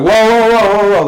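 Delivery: high, loud run of alarmed whoas; startled protest. Retold or imitated. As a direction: act out being jumped on, calling a halt. Retelling his alarm as she pounces.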